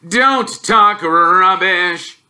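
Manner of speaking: loud, slow, high bluster; blustering uncle scolding Harry. Acted. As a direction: snap the boy down.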